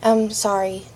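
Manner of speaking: ordinary delivery, nothing marked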